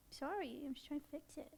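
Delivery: hushed soothing apology; soft apology to a bird. Real, not acted. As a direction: soothe an upset parrot.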